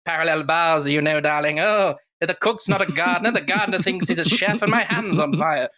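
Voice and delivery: a hint of bumbling French detective; Clouseau impression. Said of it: "started doing the Clouseau Pink Panther's Revenge".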